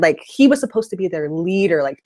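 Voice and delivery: usual speaking voice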